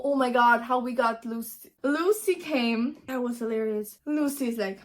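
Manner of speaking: ordinary talk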